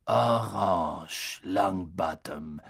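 tiefe, gedehnte Lehrerstimme voller Verachtung; verächtlicher Snape. A narrator's reading. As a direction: den Namen langsam und abfällig dehnen. Snape verhöhnt Nevilles Trank. (verächtlich, langsam gedehnt)

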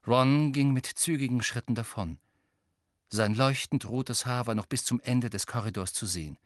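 usual speaking voice

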